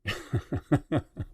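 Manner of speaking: short, low chuckle; scoffing chuckle. Real, not acted. Host dismissing an idea to listeners, scoffing chuckle. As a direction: chuckle to yourself mid-rant.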